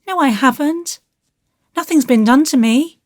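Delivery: put-on high, fast anxious little boy; a worried child, rushing; small boy voice. A narrator's reading. Davy voiced in story.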